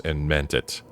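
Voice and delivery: ordinary delivery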